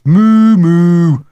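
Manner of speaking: high, loud chanted taunt; boy's mocking mooing shout; cried out. A narrator's reading. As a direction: bellow long rhyming hoots. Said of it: "cried Jeremy James".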